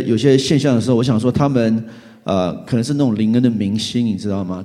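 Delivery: usual speaking voice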